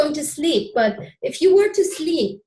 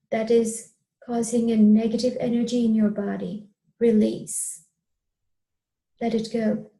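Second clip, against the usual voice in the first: hypnotic guidance. Acted. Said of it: "I will guide you, uh, with a, uh, with a, um, hypnotic, uh, N L P style guidance".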